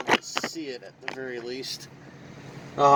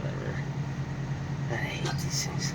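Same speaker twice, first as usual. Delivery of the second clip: frustrated whisper; 4 semitones lower, 9 dB quieter than usual. Real, not acted. Frustrated with the part.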